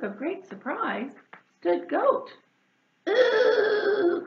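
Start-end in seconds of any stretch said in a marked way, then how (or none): 3.1-4.2 high, loud delighted ooh. Acted. Goat seeing food on hedgehog, loud high delighted ooh. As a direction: coo with greedy delight. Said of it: "cried Goat".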